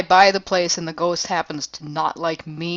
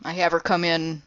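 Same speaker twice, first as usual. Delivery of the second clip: ordinary talk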